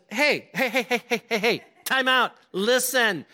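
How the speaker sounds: high, mock-scolding wife; wife cutting in. Wife halting her overreacting husband, mock scolding. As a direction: break in on a panicking husband, high and insistent. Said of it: "the wife says".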